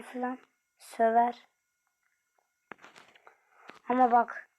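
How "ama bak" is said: ordinary delivery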